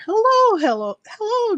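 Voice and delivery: high, loud mock calling hello; bright greeting. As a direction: imitate a seller eagerly hailing a newcomer. Seller greets accidental visitor in her show.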